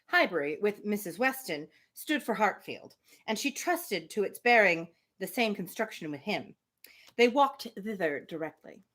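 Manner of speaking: measured read-aloud voice for a classic novel; story narration. A narrator's reading. Reading a novel chapter to the listeners, in a steady narration voice.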